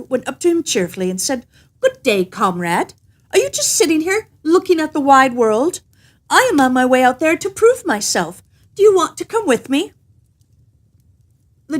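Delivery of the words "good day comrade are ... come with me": lightly raised, brisk tailor voice; a hint of a perky little man; cheerful little tailor. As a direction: greet the giant with bold cheer. Cheerful tailor greets giant.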